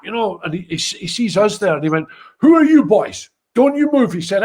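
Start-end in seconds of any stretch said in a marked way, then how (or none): 2.4-4.1 loud, high, commanding cop; a retold cop ordering them to freeze; mock cop challenge. Cop confronting the boys.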